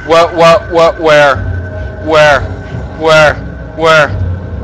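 loud frightened searching cries; panicked shouting. Real, not acted. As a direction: yell in fright.

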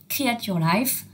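the podcast name in a slow, put-on English accent. Shown as a demonstration. Host jokingly naming the first podcast in an English accent. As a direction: show off a proud English accent on «Create Your Life». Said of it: "vous avez vu le bel accent anglais".

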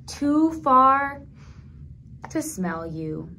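slow story line fading to a hush on Tatita; storybook reading, grief gently; tender sad reading. Acted. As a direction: let the sadness settle, trail off.

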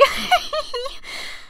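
airy laugh after apologising; real sheepish laugh; high giggle. Real, not acted.

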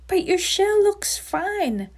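high, slow, puzzled storybook voice; confused ant. A narrator's reading. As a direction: stretch it out in bafflement. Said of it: "I'm confused, really now scratching his head".

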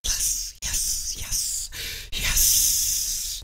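hushed, whispered repeated yeses; soft, drawn-out whisper; whispered celebration. Real, not acted. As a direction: whisper each yes with no voice. Voiceless celebration after the stomp.

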